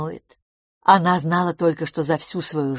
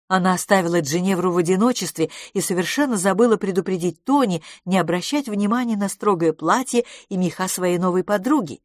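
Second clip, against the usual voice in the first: ordinary delivery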